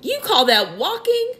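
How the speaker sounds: indignant cry. A narrator's reading. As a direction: scoff at the very idea. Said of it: "cried the centipede".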